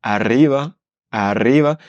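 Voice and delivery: "arriba" with a strong trilled double r; rolled r. Shown as a demonstration. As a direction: roll the rr in "arriba" hard. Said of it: "deux R en espagnol, on roule plus fortement".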